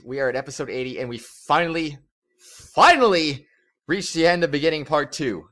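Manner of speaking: ordinary talk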